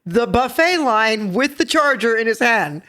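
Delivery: excited, laughing storytelling; laughing while telling. Real, not acted. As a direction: laugh your way through it. Retelling husband's banquet blunder.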